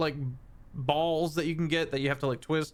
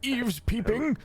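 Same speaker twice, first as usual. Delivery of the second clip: high, affronted fey character voice; indignant fey mentor; 10 semitones higher, 3 dB louder than usual. Acted. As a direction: pitch it up, like a scandalised mentor.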